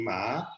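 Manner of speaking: "ma" gliding upward in pitch; rising tone. Shown as a demonstration. Lecturer recapping Mandarin tones on a graph, "ma" in rising tone. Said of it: "This is the rising tone".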